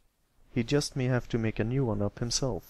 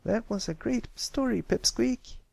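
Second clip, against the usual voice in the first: put-on much higher, grinning young filly; 12 semitones higher than usual. Applebloom praises Pipsqueak's story, grinning. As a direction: praise him with a big grin. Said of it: "Applebloom grinned".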